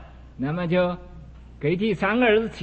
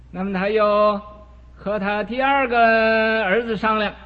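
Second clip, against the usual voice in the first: ordinary delivery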